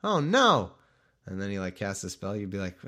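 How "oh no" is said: much higher, louder panicky squeak; mock high-voiced wizard. Shown as a demonstration. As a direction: yelp it like a frightened little wizard. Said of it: "if he had, like, a high voice".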